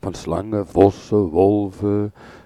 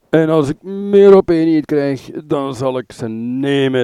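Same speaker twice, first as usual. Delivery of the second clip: put-on loud, higher drunken threat; drunken shout; 5 semitones higher, 7 dB louder than usual. A narrator's reading. Drunk Orion shouts his threat to take Merope, loud. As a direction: roar the threat, drunk and reckless. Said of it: "riep hij".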